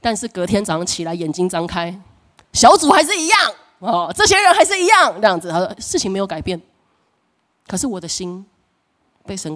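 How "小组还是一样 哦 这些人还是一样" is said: high, loud sulky whine; a put-upon small-group leader's mock whine; mock whiny complaint. Mimicking disappointed self.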